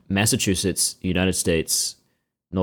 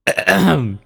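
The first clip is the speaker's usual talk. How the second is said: loud throat clear; 10 semitones higher, 5 dB louder than usual. Real, not acted. Clearing throat before speaking.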